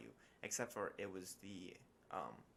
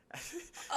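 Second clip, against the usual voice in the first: high breathy burst of laughter; 6 dB louder than usual. Real, not acted. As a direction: crack up at himself. Laughing at own blunder.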